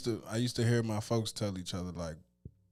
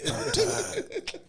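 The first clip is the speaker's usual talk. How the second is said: hammed-up pained weariness. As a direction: act worn out for the camera. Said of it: "hear the pain in my voice".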